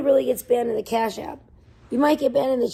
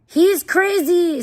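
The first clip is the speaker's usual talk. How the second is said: drawn-out, loud, higher exclamation; loud, drawn-out he's crazy; loud slow outburst; 6 semitones higher, 7 dB louder, much slower than usual. Real, not acted. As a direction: stretch it out and shout it high. Exclaiming about Psyche.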